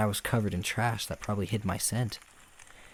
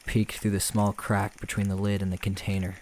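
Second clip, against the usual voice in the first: usual speaking voice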